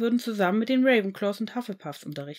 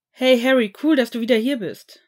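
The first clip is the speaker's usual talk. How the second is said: loud, higher, grinning greeting; 4 semitones higher, 9 dB louder than usual. A narrator's reading. Nott greets Harry back in the common room, grinning and loud. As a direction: beam at him and call out the welcome. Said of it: "sagte Sirion Nott grinsend".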